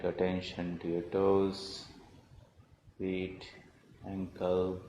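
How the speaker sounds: calm, measured voice with long pauses; guided meditation. Acted. Teacher leading beginners through body, breath and prayer, in guided meditation voice. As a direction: guide them gently, phrase by phrase, leaving space after each.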